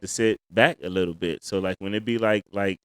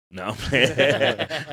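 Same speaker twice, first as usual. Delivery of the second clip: laughing; 3 semitones higher, much slower than usual. Real, not acted. Jokes after a mock complaint.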